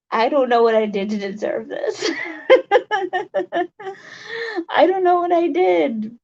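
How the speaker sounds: real tears breaking through a laugh; tearful. Real, not acted. Thanking stream viewers, choking up with a teary laugh. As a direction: voice cracking as the thanks spill out. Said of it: "I'm getting really emotional".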